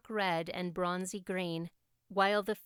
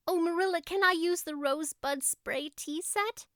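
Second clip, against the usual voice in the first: higher, gushing with delight; thrilled girl; 6 semitones higher than usual. A narrator's reading. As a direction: bubble over with excitement. Anne thrilled about having company.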